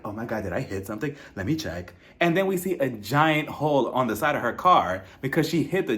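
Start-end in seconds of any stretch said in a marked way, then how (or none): 0.1-1.9 low, soft, fast fluster; lower, softer and quicker, mock-panicked driver; fussing Lisa impression. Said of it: "she's like".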